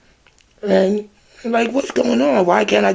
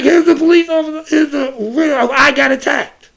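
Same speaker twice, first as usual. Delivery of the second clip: upset pleading; 7 semitones higher than usual. Retold or imitated. Speaker begging police to see the attack, upset plea. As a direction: plead with the officer, voice breaking.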